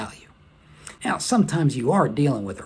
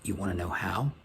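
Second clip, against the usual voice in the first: softer, confiding question; lowered voice; 5 semitones lower, 9 dB quieter than usual. Acted. Sherry drawing Major Carter in, softer and confiding. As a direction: drop the voice as if sharing a secret. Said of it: "she lowers her voice and says".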